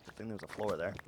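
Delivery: muttered quiet "floor"; quiet aside. Shown as a demonstration. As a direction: mutter it to yourself. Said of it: "repeated differently".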